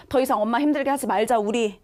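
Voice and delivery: ordinary delivery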